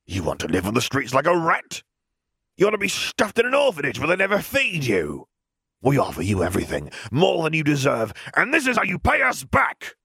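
put-on a bully threatening the orphan girl; furious rant. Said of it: "ended his rant".

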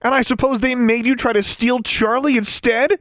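high, scornful accusing question; demanding. A narrator's reading. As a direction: press the accusation, rising at the end. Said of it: "Alexandra demanded".

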